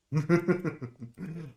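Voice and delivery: high burst of laughter. Real, not acted. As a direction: laugh at being stuck. Laughs while stuck in game.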